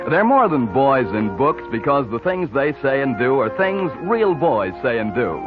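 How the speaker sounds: ordinary delivery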